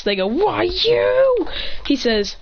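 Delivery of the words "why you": high, drawn-out cry of outrage; indignant cry. Acted. Trapped attackers to the one who caught them, indignant cry. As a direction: wail it high, stretching the last word.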